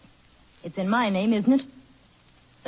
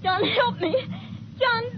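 much higher cry for help; panicked plea; 13 semitones higher, slower than usual. Acted. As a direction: call out in terror. Wife calls her husband for help.

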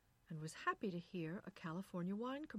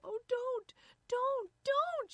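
high, loud anguished cry; frantic, tearful plea; cried; 10 dB louder, slower than usual. A narrator's reading. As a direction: cry out, begging him to stop. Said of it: "she cried".

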